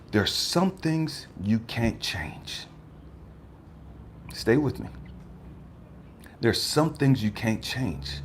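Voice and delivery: soft, slow reassurance; God calmly teaching, quiet and slow. Retold or imitated. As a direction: settle him down gently.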